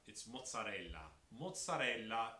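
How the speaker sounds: mozzarella with every syllable, final a kept; "mozzarella" in full, uncut Italian; standard Italian pronunciation. Shown as a demonstration. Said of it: "the Italian standard way of saying it".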